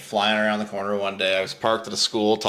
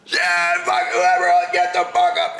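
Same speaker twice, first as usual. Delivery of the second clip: higher, mock-furious cop yell; mad cop; 6 semitones higher than usual. Cop jumping out at the boys, mock anger. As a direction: bellow it as an angry officer, hammed up. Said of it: "this cop was mad".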